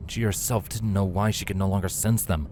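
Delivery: plain reading voice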